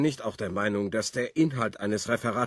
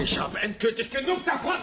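fed-up outburst; 11 semitones higher than usual. Acted. Stan storms out in anger.

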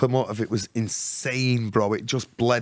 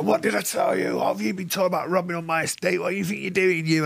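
higher, fast threatening voice; menacing gang boss; 5 semitones higher, faster than usual. Retold or imitated. Estate boss threatening the young thief, higher and fast. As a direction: bark it at the kid like a furious estate boss. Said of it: "he go".

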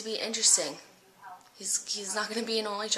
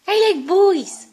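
high, loud, slow sing-song dog voice; loud high sing-song speaking for the dog; dog's voice; 10 semitones higher, 14 dB louder, slower than usual. Shown as a demonstration. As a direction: ham up the dog declaring it. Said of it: "You say".

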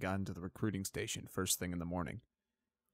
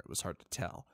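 usual speaking voice

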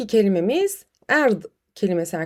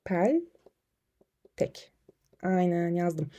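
muttered aside; 5 semitones lower, 8 dB quieter, much slower than usual. Real, not acted. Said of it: "not alayım ben".

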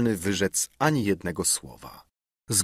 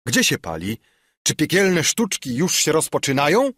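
głośniej i wyżej, z przekąsem; zawołaj zaspanym, zirytowanym starcem; wołanie dziadka; 7 semitones higher, 4 dB louder than usual. A narrator's reading. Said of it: "zawołał". (dziadek woła zbudzony w nocy)

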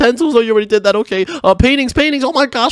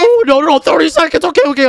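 high-pitched flustered panic at the countdown; panicked rushing; 8 semitones higher, 3 dB louder than usual. Acted. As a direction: panic as time runs out. Countdown warning, rushing to finish.

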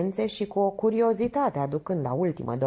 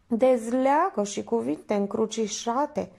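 ordinary delivery, nothing marked

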